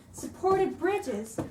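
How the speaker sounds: ordinary delivery, nothing marked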